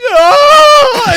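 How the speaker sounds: sudden shrieking scream, very high and loud. Retold or imitated. Sister imitating his scream as the floor opened, high shriek. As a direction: scream as the floor drops away. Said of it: "you literally went".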